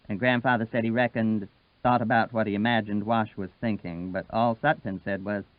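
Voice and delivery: usual speaking voice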